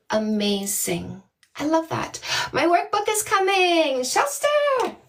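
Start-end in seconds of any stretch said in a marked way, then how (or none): 4.0-5.0 a very high delighted call of the name; high squeal of the name; excited call. Real, not acted. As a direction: call out to her, thrilled. Excitedly calling a viewer's name.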